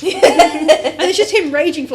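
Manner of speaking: loud laugh through the words; laughing loudly. Real, not acted. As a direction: burst out laughing mid-sentence. Laughing while describing the demon.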